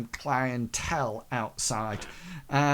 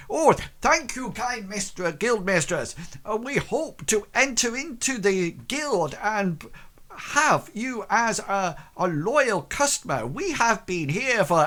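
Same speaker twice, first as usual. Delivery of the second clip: high, eager shopkeeper voice; fawning baker; 8 semitones higher, 4 dB louder than usual. Acted. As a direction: gush like a grovelling baker. Baker character voice.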